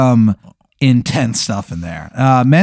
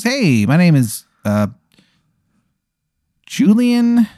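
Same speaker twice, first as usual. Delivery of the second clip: higher-pitched, put-on server greeting; chirpy waiter; 7 semitones higher than usual. Waiter introduces himself to the diner, chirpy and high. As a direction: play an over-friendly waiter introducing himself. Game master plays eager waiter.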